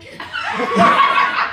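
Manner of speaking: shrieking laugh; high squeal of laughter. Real, not acted. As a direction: break down into high giggles.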